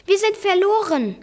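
high, mildly panicked cry; alarmed exclamation. A narrator's reading. Nephew to his uncle on the raft, alarmed cry. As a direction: call out as if all is lost. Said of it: "rief ich aus".

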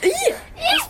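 high squealing refusal; disgusted squeal. Acted. Boy refuses to catch creature.

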